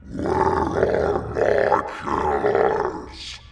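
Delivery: very slow, low, rasping monster; demanding ghoul. A narrator's reading. The Bogman confronting Helen's parents, low and rasping. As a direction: rasp the demand menacingly. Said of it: "he said".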